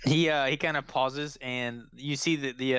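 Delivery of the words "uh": ordinary talk, nothing marked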